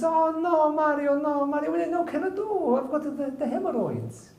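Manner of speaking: high-pitched wailing woman in protest; hammed-up falsetto whine; Maria's voice. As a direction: put on a very high, drawn-out wife voice. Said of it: "Maria says".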